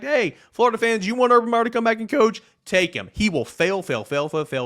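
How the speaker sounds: gleeful taunt. Real, not acted. Taunting rival fans.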